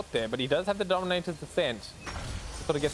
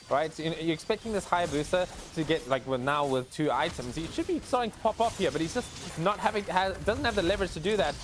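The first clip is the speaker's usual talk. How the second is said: ordinary delivery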